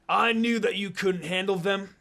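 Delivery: a touch of a gloating thug; villain yelling. A narrator's reading. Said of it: "Gato yelled".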